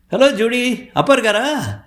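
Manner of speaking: high sing-out greeting; very high, playful question; calling to a dog. Acted. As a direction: call out high to the pet dog.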